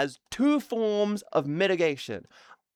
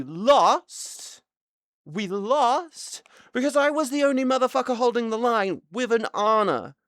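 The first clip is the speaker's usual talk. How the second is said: high, exasperated outburst; furious complaint; angry rant; 7 semitones higher than usual. Real, not acted. Ranting about losing the match.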